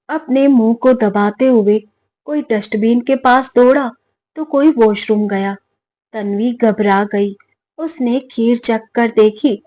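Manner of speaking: ordinary delivery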